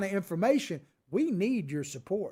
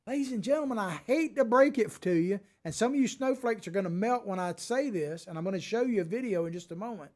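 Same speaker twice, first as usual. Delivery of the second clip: ordinary talk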